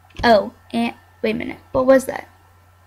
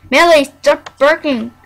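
louder sharp scold; scolding dog; 2 semitones higher, 8 dB louder than usual. Real, not acted. Scolding the barking dog, louder. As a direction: tell the dog off firmly.

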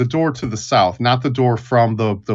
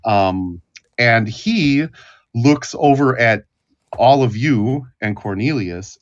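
ordinary delivery

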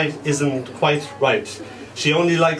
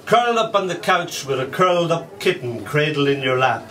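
ordinary talk, nothing marked

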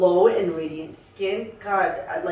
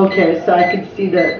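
ordinary talk

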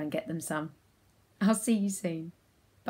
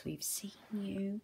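whispering; 10 dB quieter than usual. Real, not acted. Whispering while spotting robots.

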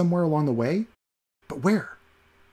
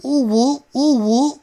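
very high, louder squeaks; 13 semitones higher, 7 dB louder, much slower than usual. Acted. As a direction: squeal through puckered lips. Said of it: "squeaked the doorkeep through puckered lips".

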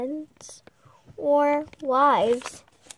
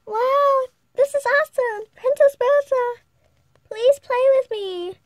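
high, loud and eager; excited little girl; 10 semitones higher, 5 dB louder than usual. Acted. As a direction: beg excitedly like a small child.

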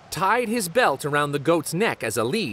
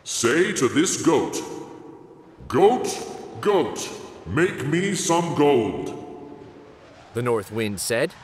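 a hint of a howling wind spirit; gruff wind. North wind voice.

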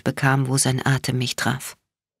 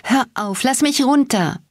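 high-pitched, strained plea; squeezed out; 9 semitones higher than usual. A narrator's reading. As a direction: squeeze out the protest, pitch climbing. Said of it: "quetschte ich hervor".

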